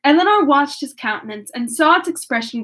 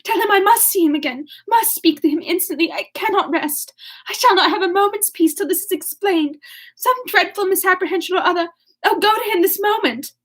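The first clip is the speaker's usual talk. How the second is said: high, agitated pleading; urgent cry; 6 semitones higher than usual. A narrator's reading. Marianne demanding to see Willoughby, agitated and urgent. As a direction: plead in a raised pitch, unable to rest. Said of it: "she cried as soon as she could speak".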